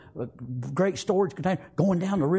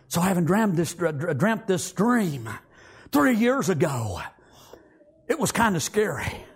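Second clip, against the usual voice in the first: usual speaking voice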